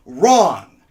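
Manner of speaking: sudden, very loud one-word outburst; emphatic rebuttal. Acted. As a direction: slam it down like a fist on a desk. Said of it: "can't hit a desk when I say that".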